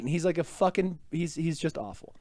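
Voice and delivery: ordinary talk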